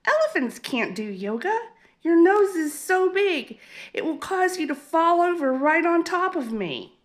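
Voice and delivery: a tiny mouse mocking the big nose; mean little mouse. A narrator's reading. Mouse voiced, mocking Ellie's nose.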